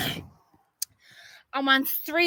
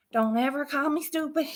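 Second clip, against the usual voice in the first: usual speaking voice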